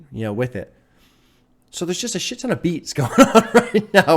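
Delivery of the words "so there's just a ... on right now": real laughter bubbling through the words, louder and higher; laughing excitedly. Real, not acted. Reacting to a song, laughing in excited amazement. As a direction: laugh it out, overwhelmed by the beats. Overwhelmed by the song's beats.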